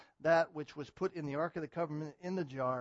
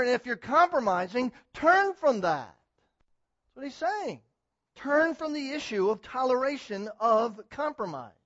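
full-force preaching; 7 semitones higher, 13 dB louder than usual. Acted. Pastor thundering at his church to turn from compromise, loud. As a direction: preach it hard, hammer every warning.

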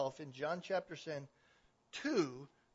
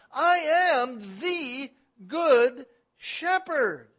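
loud, high proclamation, slowing hugely on the last sentence; full-force preaching; 10 semitones higher, 14 dB louder than usual. Acted. Preacher voicing Jesus' claim to Israel, full-force proclamation. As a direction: ring it out, each final word stretched and weighty.

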